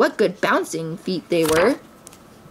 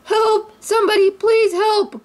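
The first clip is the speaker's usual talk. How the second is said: cried for help; 13 semitones higher than usual. A narrator's reading. Tigger stuck in the tree crying out for help. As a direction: beg for rescue, stuck up high. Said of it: "cried Tigger".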